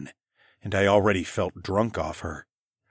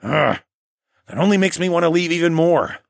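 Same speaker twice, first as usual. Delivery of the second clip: gritted teeth; 8 semitones higher, 5 dB louder than usual. A narrator's reading. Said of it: "through gritted teeth".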